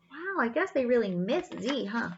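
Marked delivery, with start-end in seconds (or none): none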